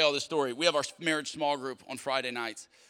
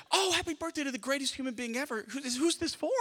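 much higher lady voice reading the cake aloud; checkout lady; 9 semitones higher than usual. Retold or imitated. Cashier reading the birthday cake message to the pastor, high and amused. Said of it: "The exact same thing plays out again".

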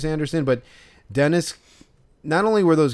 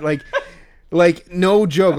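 usual speaking voice

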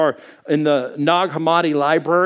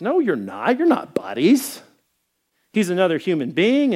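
ordinary talk, nothing marked

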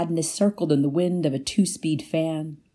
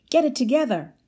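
scolding; 8 semitones higher than usual. Retold or imitated. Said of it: "I scolded myself".

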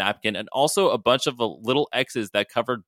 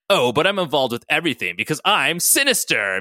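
theatrical, higher-pitched villain boast; striking a pose; 5 semitones higher, 3 dB louder than usual. A narrator's reading. Sinister inserts himself into everything, posing theatrically. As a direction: pose grandly with the voice. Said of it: "said with a pose".

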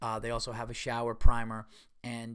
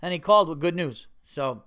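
ordinary talk

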